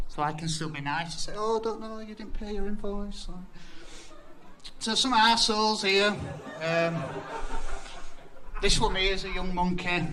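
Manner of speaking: ordinary talk, nothing marked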